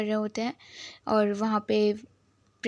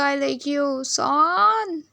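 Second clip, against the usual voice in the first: put-on rising high and a bit louder on the name, sweet and childlike; cutely said; 4 semitones higher, 4 dB louder, much slower than usual. Drunk Juen cutely confessing to Son, sweet and high. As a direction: coo it like a tipsy little brother. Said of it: "कितना cutely बोल रहा था".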